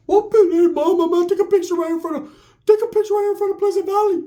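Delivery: very high, loud falsetto impression; hammed-up high-pitched man; Ricky's high-pitched voice. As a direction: call out in a squeaky falsetto. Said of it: "He has that high-pitched voice".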